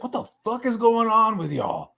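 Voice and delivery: high-pitched exasperated outburst; mock outburst. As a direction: act out openly losing it at the kids. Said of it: "I wasn't just like".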